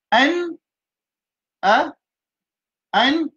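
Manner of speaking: letters called out high and sharp; spelled letters. Shown as a demonstration. Teacher spelling letters out high to make students listen. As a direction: call each letter out clearly. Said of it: "écoute bien".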